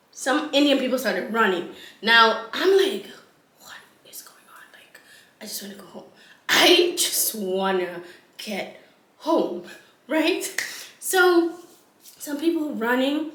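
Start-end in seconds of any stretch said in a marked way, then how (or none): 3.6-6.0 hushed, whispered inner thought; whispered plea. Retold or imitated. Tired woman watching the chaos, whispered plea. 6.5-9.6 slow, voiced mock plea; drawn-out plea. Tired woman repeating her wish, slow drawn-out plea.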